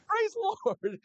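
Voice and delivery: high, bursting joyful exclamation; joyful praise. Retold or imitated. Told he gets a free tire, high joyful praise. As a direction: cry it out in high delight.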